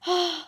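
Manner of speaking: long, loud high scream, then a short one; piercing shriek of alarm; horrified scream. Real, not acted. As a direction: scream as if ambushed. Killed by creeper in game.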